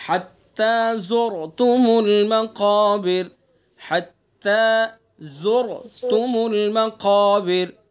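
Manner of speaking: slow melodic tilawat with long drawn vowels; slow Quran recitation. Shown as a demonstration. Teacher recites the surah for students to copy, slow melodic recitation. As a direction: chant each verse slowly, stretching the vowels. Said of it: "یہ بتائیے را کیسی ہوگی؟".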